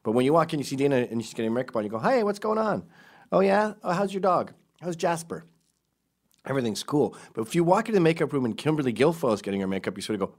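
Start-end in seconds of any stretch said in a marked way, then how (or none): 2.0-5.4 high, chirpy hello to a colleague; bright friendly greeting. Retold or imitated. Greeting Dana in the makeup room, high and chirpy.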